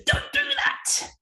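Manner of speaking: squeaky imitation of the teacher's 'Don't do that.'; high-pitched mock teacher. Son imitating teacher scolding him, high mock voice. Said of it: "she goes".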